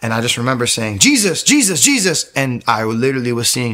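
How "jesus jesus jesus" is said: high, urgent repeated call. Retold or imitated. Alone at home seeing shadows, calling out. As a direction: call the name out as if warding something off.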